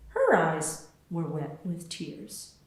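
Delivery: usual speaking voice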